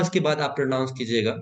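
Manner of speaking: normal talking voice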